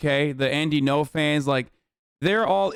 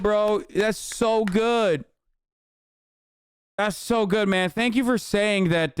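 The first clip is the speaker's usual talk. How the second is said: gushing mock gratitude, pitched up; sarcastic praise; 4 semitones higher, slower than usual. Streamer thanking chatter for an obvious take, sarcastic praise. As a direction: congratulate with heavy sarcasm, drawing out the praise.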